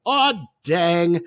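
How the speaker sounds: a silly, disappointed little groan of a line; Greg Kinnear's aw dang. Retold or imitated. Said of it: "Greg Kinnear's delivery of".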